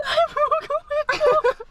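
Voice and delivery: high, teary voice through sobs; mock sobbing confession. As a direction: play it as a sobbing kid confessing. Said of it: "Sobbing, sobbing".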